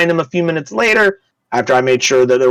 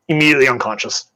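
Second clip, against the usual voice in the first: ordinary delivery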